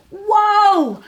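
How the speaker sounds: high, loud amazed whoa; amazed cry. Acted. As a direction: gasp in wonder. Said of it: "cried little Hedgehog".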